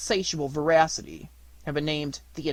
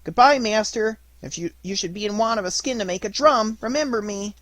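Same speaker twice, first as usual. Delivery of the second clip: higher, louder mocking farewell; calling out; 6 semitones higher, 4 dB louder than usual. A narrator's reading. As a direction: call it across the water teasingly. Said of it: "he called to his poor owner".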